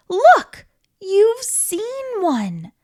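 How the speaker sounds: loud high exclamation; excited. Acted. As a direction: burst out with delight. Excited reveal to child listener.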